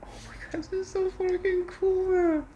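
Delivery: giddy high-pitched delight; excited squeal. Real, not acted. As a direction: squeal the praise. Excited over laptop setup.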